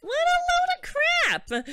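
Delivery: shrieked, very high mock exasperation; irritated. Yuna dismissing his illusion story, irritated high shriek. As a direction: squeal it out high and loud in irritation. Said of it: "She frowned with an irritated voice".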